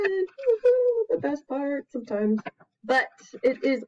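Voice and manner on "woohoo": high, bright woohoo; playful cheer. Acted. Teacher cheers about messy play for the kids, high playful woohoo. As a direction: cheer the fun of the mess.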